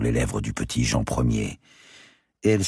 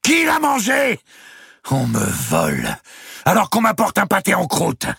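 very high, loud demanding shriek; outraged shouting; 5 dB louder than usual. A narrator's reading. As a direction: accuse the household at full voice. Countess cries she is robbed.

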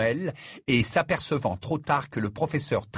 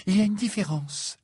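high, mildly haughty reply; dignified retort; 9 semitones higher than usual. A narrator's reading. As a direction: stand on your dignity, pitch lifted. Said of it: "avec dignité".

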